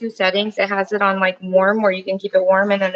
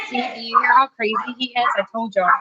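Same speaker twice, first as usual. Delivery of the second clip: normal talking voice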